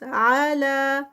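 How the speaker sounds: slow, drawn-out tajweed recitation; Quranic recitation. Shown as a demonstration. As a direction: chant it slowly, stretching each sound. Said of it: "ٹو counts". (آہستہ کھینچ کر تلاوت)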